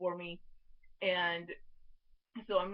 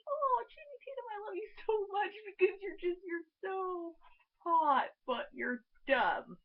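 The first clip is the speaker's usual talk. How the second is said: fond gushing; 10 semitones higher than usual. Acted. Fan telling Channing Tatum she loves him, adoring gush. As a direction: swoon over a lovable dummy, starting very high.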